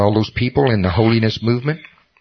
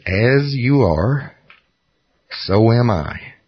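slow emphatic reading; much slower than usual. Retold or imitated. Reading the verse slowly, emphatic.

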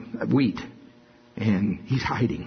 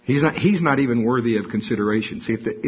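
usual speaking voice

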